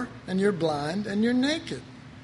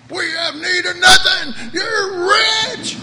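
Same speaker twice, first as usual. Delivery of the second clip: much higher and louder, a wailing mock cry; overdone sobbing brag; whiny boast; 11 semitones higher, 10 dB louder than usual. Parodying Christians who claim they need nothing, a high, loud mock wail. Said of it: "I've heard Christians say that stuff".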